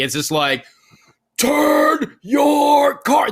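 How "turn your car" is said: high, loud, very drawn-out yell; exasperated driver's shout, every word stretched; frustrated yell. Acted. Driver yelling at cars stopped at red light, exasperated.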